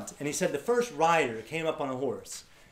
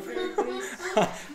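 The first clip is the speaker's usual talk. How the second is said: high laugh. Real, not acted. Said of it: "laughing at himself".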